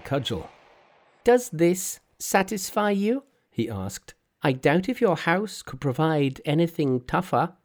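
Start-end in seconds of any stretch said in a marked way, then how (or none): 1.2-3.2 high, perky monkey king voice; a cocky trickster, pitched up; cheeky monkey hero. Acted. Monkey's voice in read story. 4.4-7.6 high, perky monkey king voice; a cocky trickster, pitched up; cheeky monkey hero. Acted. Monkey's voice in read story.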